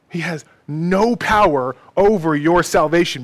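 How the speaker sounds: loud, ringing assurance; forceful proclamation. Real, not acted. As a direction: proclaim it with full conviction. Preacher repeats the assurance forcefully.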